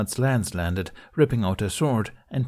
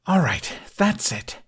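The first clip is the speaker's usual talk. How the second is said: higher, slow snarl of a fed-up knight; 10 semitones higher than usual. A narrator's reading. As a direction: bite the words out, done with them. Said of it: "Astrid snarled".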